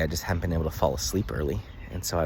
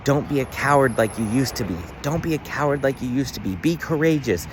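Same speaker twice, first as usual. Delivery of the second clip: reliving a dream, shouting much higher at mom to tell the truth; retold yell; 8 semitones higher than usual. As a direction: yell it up high like you're pushing her to be brave. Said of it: "I was yelling at her".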